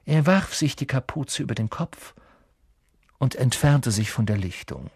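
ordinary delivery

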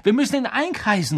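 high, loud urgent call; rally the others as you shout. A narrator's reading. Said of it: "rief Harry". (Harry ruft den Freunden laut zu)

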